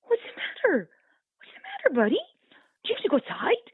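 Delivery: high, sing-song coo at the puppy; doting owner baby talk. Retold or imitated. Owner cooing at the barking puppy, high sing-song baby talk. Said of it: "turn to their puppy and go".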